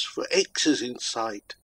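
plain reading voice

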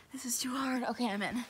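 hushed late-night whisper. Real, not acted. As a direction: whisper so nobody wakes. Late night, others sleeping.

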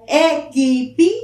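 normal talking voice

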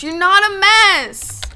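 high, loud, drawn-out wail; emphatic protest. Real, not acted. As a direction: insist on it, slow and loud. Protests friend's self-blame.

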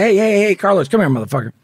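re-enacted shout to stop a man; retold confrontational call. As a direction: holler at someone to get over here, retelling it. Retold calling out to confront Carlos.

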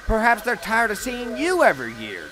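high, loud, needling jab; taunting. A narrator's reading. Rival James taunts Thomas.

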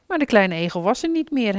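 usual speaking voice

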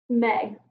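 "meg" blended into one word. Shown as a demonstration. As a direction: say "meg" joined up after its separate sounds. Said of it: "we'll blend it".

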